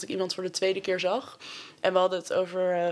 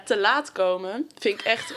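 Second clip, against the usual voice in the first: normal talking voice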